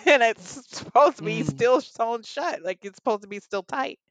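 high, incredulous retelling with a laugh; laughing disbelief. Retold or imitated. Patient recounting her reaction to the nurse's finding, laughing disbelief. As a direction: protest it with a laugh, voice lifting.

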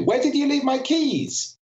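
very high, agitated question at an even pace; high-pitched arousal. Shown as a demonstration. Speaker showing an audience how pitch indicates arousal, high and agitated. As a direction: pitch it right up, emotionally worked up. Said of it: "a very different emotional impact".

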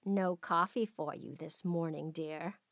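low, softly condescending; mean taunt. A narrator's reading. Stepmother withholding coffee from her stepdaughter, mean taunt.

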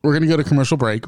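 fast radio host delivery; quick upbeat DJ announcement; announcer voice. Acted. Host announcing break again.

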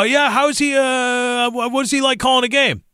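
high, breezy imitation of a baseball insider; hammed-up folksy scout voice; mock scout talk. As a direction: parody the casual scout vouching for a weak player. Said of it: "they go".

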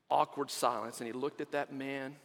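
quiet, low suspense; hushed suspense. A narrator's reading. Storyteller builds tension.

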